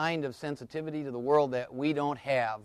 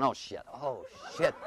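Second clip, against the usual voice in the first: put-on low-key inner child's dread; soft mock-panicked aside; inner little kid; 3 semitones lower, 9 dB quieter than usual. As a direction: mutter it as a dismayed inner kid. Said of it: "My little kid's in there going".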